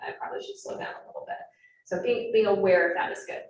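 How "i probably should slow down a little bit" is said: quick mutter under the breath; muttered to self. Retold or imitated. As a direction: rush it out as a whispered aside. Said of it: "I'm like".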